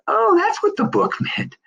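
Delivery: high, laughing mock revelation; sudden realization. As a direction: play the penny dropping with a laugh. Realizing what the book meant.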